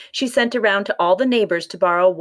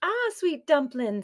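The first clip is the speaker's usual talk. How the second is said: soft, higher, slow coo; tender cooing; 8 semitones higher, 6 dB quieter than usual. A narrator's reading. Oonagh to baby Finn, soft tender coo. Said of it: "said Oonagh".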